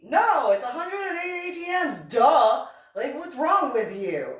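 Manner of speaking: high, loud mock outburst; singsong it like he's an idiot; hammed-up, incredulous yell; exasperated correction. Retold quarrel, exasperated correction.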